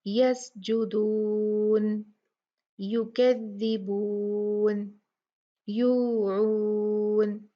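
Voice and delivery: यसजुदून, युकद्दिबून stretched out over many counts; very slow recitation, last vowels drawn out; long vowels stretched. Shown as a demonstration. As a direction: chant each word holding the final vowel long. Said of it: "चार या छह count खींच के पढ़ सकते हैं".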